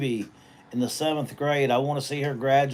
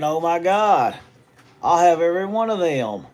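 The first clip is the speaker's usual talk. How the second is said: higher, louder mock dismay; retold dismay; 4 semitones higher, 5 dB louder than usual. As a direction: voice your own alarmed thought out loud. Acts out alarmed reaction to side effects.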